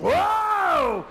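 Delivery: high, loud sustained wail; wife's labour howl. Retold or imitated. Wife in the back seat, long pained howl. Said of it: "My wife's in the back seat going".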